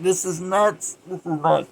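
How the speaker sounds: high, loud disbelief with laughter around it; hammed-up astonishment; mock incredulous outburst. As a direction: exclaim it like you can't believe it. Voicing reaction to crazed cop.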